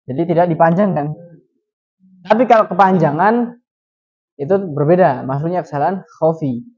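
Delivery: ordinary talk, nothing marked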